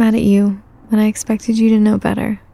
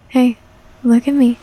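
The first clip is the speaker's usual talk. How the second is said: ordinary delivery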